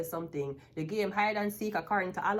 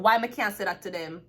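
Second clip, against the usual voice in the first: louder, indignant comeback; defiant retort; 6 dB louder than usual. Acted. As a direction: fire back loudly at the hushed warning. Defiant answer to the whispered warning.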